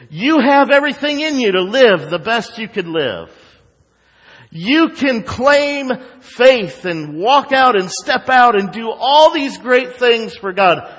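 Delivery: high, loud mock preacher hype; false teachers. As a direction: parody a rousing motivational preacher. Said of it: "with the false teachers today".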